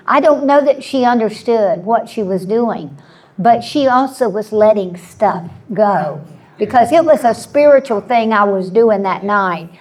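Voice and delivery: ordinary delivery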